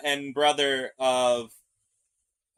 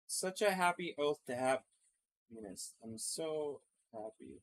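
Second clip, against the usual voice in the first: mock-happy, hushed and dry; sarcastic; 13 dB quieter than usual. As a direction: say it sarcastically, deadpan.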